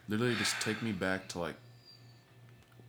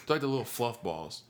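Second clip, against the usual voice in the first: usual speaking voice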